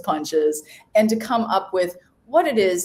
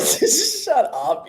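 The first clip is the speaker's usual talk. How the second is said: giggling shut up, high and playful; laughing; 12 semitones higher, 3 dB louder than usual. Real, not acted. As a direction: laugh it off at a dorky joke. Teasing friend after a joke.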